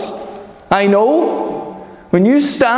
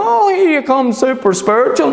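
mocking scoffer; 6 semitones higher than usual. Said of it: "people pounce on you".

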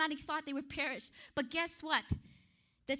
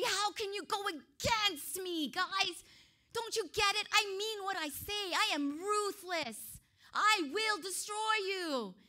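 furious king raging at the men, higher and louder; angry king; 6 semitones higher, 4 dB louder than usual. Acted. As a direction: play the enraged king, ruthless. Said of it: "the king was filled with rage".

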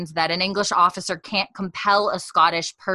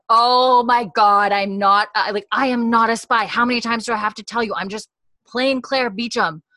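louder, higher fed-up shouting, at the end of her rope; exasperated outburst; 5 semitones higher, 7 dB louder than usual. Retold or imitated. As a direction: she has had it, blurt it out. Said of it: "Claire has had a day".

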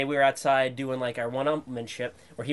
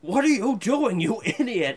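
louder, much higher mock-angry old man; a hint of a cross grandfather; grandfather scolding; 9 semitones higher, 5 dB louder than usual. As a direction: bark at the kid with a laugh breaking in. Grandfather scolding in retold story.

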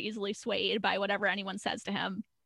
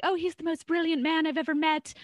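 higher, gushing mock swoon; gushing Diane; 7 semitones higher, 3 dB louder than usual. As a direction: swoon over him, hammed up.

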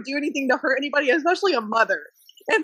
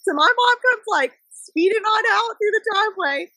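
ordinary talk

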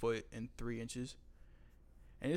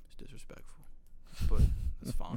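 hushed, teasing disapproval; mock-disapproving whisper; 7 dB louder than usual. Telling the boy his sauce is disrespectful, a mock whisper. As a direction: whisper it like a playful scolding. Judging boy's barbecue sauce.

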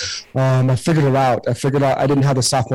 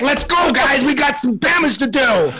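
high, shouted Japanese-accent impression; Ichiro; 11 semitones higher than usual. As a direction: imitate a team rallying cry in a Japanese accent. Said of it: "in his Japanese accent".

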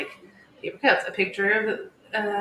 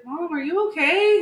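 put-on high, louder little kid question; imitated concerned son; 10 semitones higher, 7 dB louder than usual. Eli checking on bawling mom, imitated child voice. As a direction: ask in a raised child's voice.

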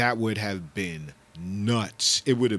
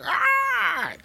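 a sudden high squeal of delight; high excited shriek; 4 dB louder than usual. Real, not acted. Excited cry remembering the show.

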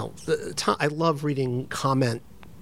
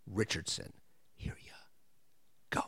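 breathy hushed whisper; 8 semitones lower, 16 dB quieter than usual. Real, not acted. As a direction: drop to a whisper. Hushed handoff to the interview.

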